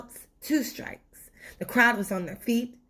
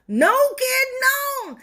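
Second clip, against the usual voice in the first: high, louder, crying plea; panicked tooth; 6 dB louder than usual. Acted. Tooth begs Stuart to spare it from the carrot, high crying panic. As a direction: plead in terror.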